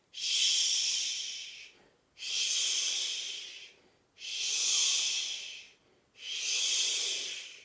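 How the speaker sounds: long voiceless shushing swish; skiing swish. Shown as a demonstration. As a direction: hiss like skis sliding down snow. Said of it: "go skiing down a snowy mountain".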